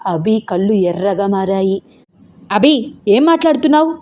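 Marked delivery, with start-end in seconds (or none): 2.5-3.9 higher, sharp reproach; mother angered by daughter's words; angry. Acted. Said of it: "కోపంగా అంది స్వప్న". (కోపంగా)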